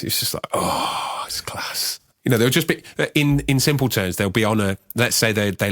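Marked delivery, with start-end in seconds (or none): 0.0-2.0 whispering. Real, not acted. Savouring the chords, breathy.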